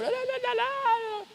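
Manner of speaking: high, soft mocking "da da" babble; mock chatter. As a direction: babble like gossiping people, squeaky and high. Mimicking gossip chatter.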